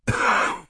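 short repeated hacking; acted cough. Mister Poe takes in the news, coughing. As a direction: cough in character between questions.

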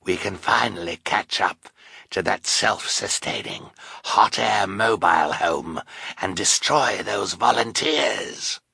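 sinister hoarse villain voice. A narrator's reading. Sinister man plotting to destroy the volunteers, hoarse. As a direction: rasp out the plan hoarsely. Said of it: "said in his hoarse voice".

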